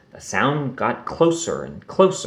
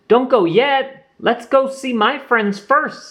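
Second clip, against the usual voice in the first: put-on young Roo pleading; 13 semitones higher than usual. A narrator's reading.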